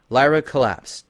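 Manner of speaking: usual speaking voice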